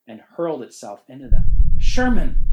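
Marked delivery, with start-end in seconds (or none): 1.8-2.4 sudden high yell of fright; startled shout. Acted. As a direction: shout the name in shock. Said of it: "Billy croaked".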